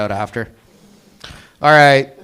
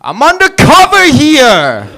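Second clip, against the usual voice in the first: put-on loud, slow, higher New York accent yell; brash New York accent shout; New Yorker impression; 12 semitones higher, 9 dB louder, slower than usual. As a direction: belt it like a New Yorker on the street. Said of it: "New Yorker as a secret agent".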